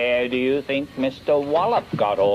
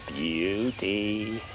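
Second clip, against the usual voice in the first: a slow, softer repeat of beauty, relishing it; savoured softly; 4 semitones lower, 6 dB quieter, slower than usual. Acted. Snail relishing his idea to himself, slow and soft. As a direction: murmur it to yourself, slowly and softer.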